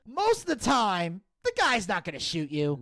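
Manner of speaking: ordinary talk, nothing marked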